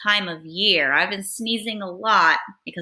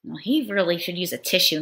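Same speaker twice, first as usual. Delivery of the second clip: ordinary delivery, nothing marked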